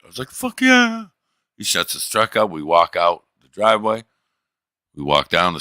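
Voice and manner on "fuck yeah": much higher, elated cheer; thrilled owner hearing good news; excited. Retold or imitated. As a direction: yelp with joy. Neighbor agrees to help.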